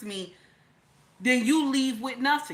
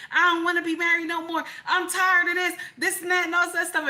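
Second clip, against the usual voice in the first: higher, louder whiny outburst; tantrum; 7 semitones higher, 3 dB louder, faster than usual. Retold or imitated. Wife whining to her husband she's done, mock tantrum. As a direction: throw a mock tantrum, pitched up. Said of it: "I would have a tantrum moment".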